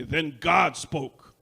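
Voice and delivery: loud, very slow Then God spoke; preacher's proclamation. Retold or imitated. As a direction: boom it out, drawn out. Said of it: "after every phrase he would say".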